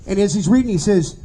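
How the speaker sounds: ordinary talk, nothing marked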